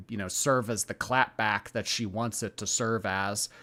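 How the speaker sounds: ordinary talk, nothing marked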